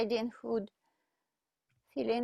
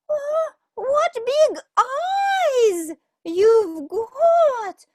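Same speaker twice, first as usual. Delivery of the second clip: much higher, louder and slow, in awe; amazed girl; 13 semitones higher, 9 dB louder, much slower than usual. Acted. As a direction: draw it out in wide-eyed wonder.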